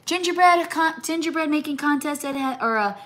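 high, bubbly pitch all through; excited invitation. Real, not acted. Inviting viewer moms to a gingerbread party, high excitement. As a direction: gush about the party idea.

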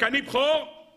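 high-pitched imitation of an indignant claim; mock shout. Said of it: "יעקב צועק".

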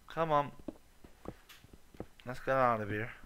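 usual speaking voice

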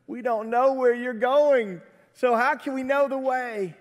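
acted baffled plea; bewildered protest. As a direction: raise the pitch, as a confused disciple protesting. Voices Thomas questioning Jesus.

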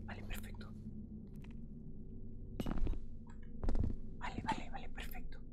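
whispered relief. Real, not acted. Sneaking past sleeper, whispered relief. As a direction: breathe it out in a whisper.

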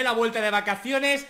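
normal talking voice

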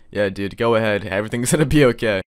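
normal talking voice

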